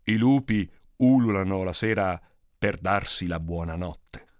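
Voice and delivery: put-on slow, low old storyteller; smiling old man. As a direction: answer slowly and low, with a smile. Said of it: "Il vecchio Ali sorrise e disse". (vecchio sorridente, lento e basso)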